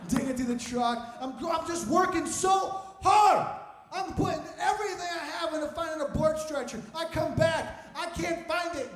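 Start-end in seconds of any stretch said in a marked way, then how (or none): 1.4-8.9 high, loud storytelling strain; raised voice, playing up the struggle; worked-up exasperation. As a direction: ham up the desperate effort. Acts out his desperate searching.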